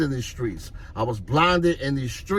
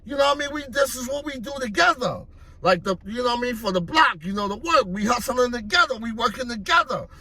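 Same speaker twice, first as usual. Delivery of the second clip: hyped vouching; 9 semitones higher, faster than usual. Retold or imitated. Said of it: "He was like".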